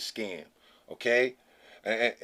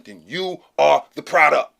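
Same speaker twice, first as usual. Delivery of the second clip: emphatic; 3 semitones higher, 9 dB louder than usual. Real, not acted. Driving home the warning.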